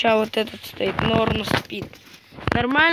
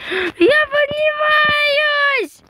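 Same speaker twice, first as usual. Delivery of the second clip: very high, slow squeal; high drawn-out cry; 4 dB louder, much slower than usual. Pretend. Flying up in game.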